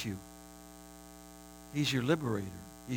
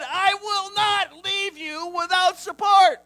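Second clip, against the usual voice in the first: fiery preaching; 9 dB louder than usual. Acted. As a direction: cry out the promise at full force. Preacher at peak, shouting promise.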